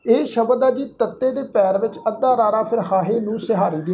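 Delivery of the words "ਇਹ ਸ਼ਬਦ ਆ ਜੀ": ordinary talk, nothing marked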